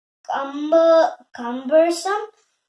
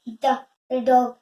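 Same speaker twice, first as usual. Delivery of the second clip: ordinary delivery, nothing marked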